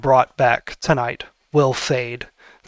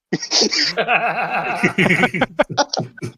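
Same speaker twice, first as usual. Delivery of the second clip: high burst of laughter; real laugh; 6 semitones higher than usual. Real, not acted. GM laughs at player joke.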